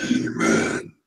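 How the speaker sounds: deep, growling demon; low, menacing demon voice. Pretend. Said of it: "That was my demon voice".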